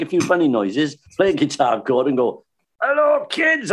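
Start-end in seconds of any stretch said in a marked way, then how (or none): 2.8-3.7 put-on high, bright call to the kids; Stan's stage greeting. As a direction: call out to a room of children. Stan greeting children on stage.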